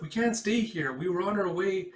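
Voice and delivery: high urgent protest; girl protesting to a warrior. Acted. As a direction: push back nervously.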